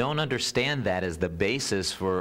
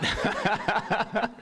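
high giggle after the quip; high amused laugh; real high laugh; 14 semitones higher than usual. Real, not acted. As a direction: laugh along at yourself. Laughs at own setup.

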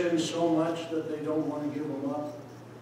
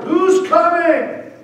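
higher, louder, slow stretched call; 10 semitones higher, 11 dB louder, much slower than usual. Acted. Blind beggar calling again, a little louder, slowly drawn out. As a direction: call again, pushing harder. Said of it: "he said it again a little louder".

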